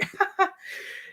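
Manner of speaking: high, airy put-on chuckle; mock boss laugh. Boss's dismissive laugh, acted.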